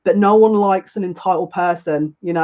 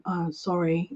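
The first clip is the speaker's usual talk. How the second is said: meek apology; 11 dB quieter, slower than usual. Retold or imitated. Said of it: "he went".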